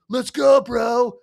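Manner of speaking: high, loud bro-speak; sling it like a laid-back surfer kid; surfer-dude teen. Retold or imitated. Mocking how Cameron's teen characters talk, high bro voice. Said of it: "that's how they talk all the time".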